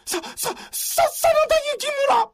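very high, stuttering panic; terrified stammer. Acted. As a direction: choke on the name in fear.

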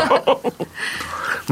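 high airy laughter; real high-pitched laugh without words. Real, not acted. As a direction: burst out in amused laughter. Laughs about all being Kansai people.

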